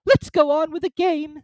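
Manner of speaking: high, loud imperious queen. Acted. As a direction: command like a tyrant queen. Queen character voice.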